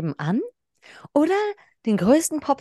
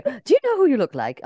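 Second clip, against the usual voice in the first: put-on light British accent, same question; English accent; 7 semitones higher, 4 dB louder than usual. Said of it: "hörst du den englischen Akzent?".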